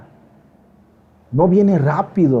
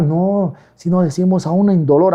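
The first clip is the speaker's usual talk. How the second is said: ordinary talk, nothing marked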